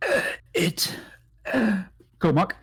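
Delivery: high dying croak; dry cracking voice. Pretend. Dying drow tries to speak, dry cracked croak. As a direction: gasp out broken words through a parched throat. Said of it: "has this dry voice that cracks".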